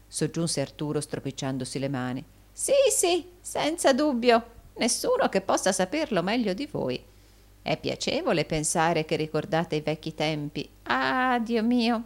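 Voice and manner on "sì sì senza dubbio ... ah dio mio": high eager agreement, settling midway; wheedling, pitched up; fawning old usurer, gleeful. Acted.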